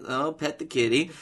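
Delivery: normal talking voice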